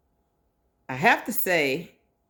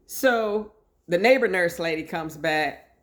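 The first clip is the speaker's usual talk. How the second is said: ordinary delivery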